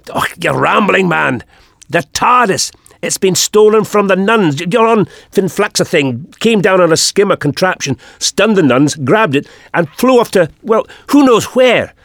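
high, urgent character voice; exasperated, agitated Highlander. A narrator's reading. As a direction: blurt out the bad news, exasperated. Jamie berates the Doctor.

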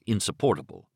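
usual speaking voice

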